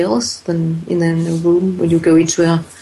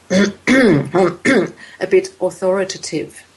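throat clearer; slower than usual. Shown as a demonstration. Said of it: "the throat clearer".

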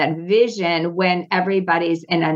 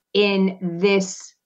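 ordinary talk

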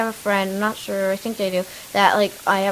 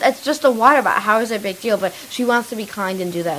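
ordinary talk, nothing marked

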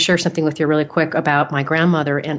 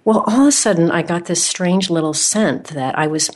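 usual speaking voice

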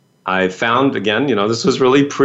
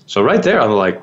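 ordinary talk, nothing marked

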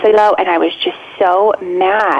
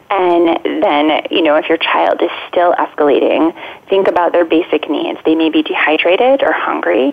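ordinary delivery

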